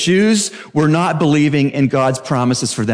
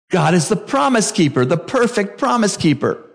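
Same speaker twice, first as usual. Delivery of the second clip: ordinary talk, nothing marked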